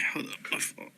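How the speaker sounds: breathy whispered apology; whispered aside. Real, not acted. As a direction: drop to a whisper for a quick sorry. Aside during word list.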